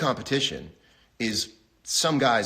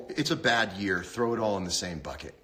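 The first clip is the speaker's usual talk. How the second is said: ordinary talk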